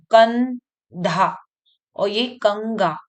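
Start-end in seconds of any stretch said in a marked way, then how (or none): 0.1-1.4 "कंधा" drawn out as kann-dhaa; half-n sound. Shown as a demonstration. Teacher showing pupils the half न, stretched n in "कंधा". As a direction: hold a clear n in "कंधा", slowly. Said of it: "तो यहाँ पे न आधा".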